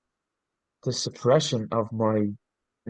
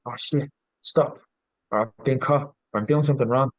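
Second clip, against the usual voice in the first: caught out; 3 semitones higher than usual. Retold or imitated. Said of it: "a bit of embarrassment came with it".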